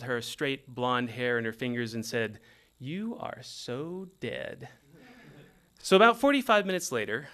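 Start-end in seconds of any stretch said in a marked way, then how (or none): 2.8-4.7 teasing murmur. Retold or imitated. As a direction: let it drip out quietly, slow and knowing. Girlfriend teasing, car stuck.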